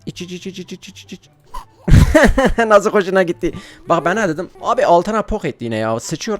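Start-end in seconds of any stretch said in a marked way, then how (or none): 0.1-1.3 softly chanted, higher-pitched coaxing; quiet, repeated little urgings; hushed urging. Real, not acted. Player feeding the baby milk, hushed urging. 1.9-2.6 loud, high laugh; sudden high-pitched cackling burst; burst of laughter. Real, not acted. Player amused by the baby, loud laughter.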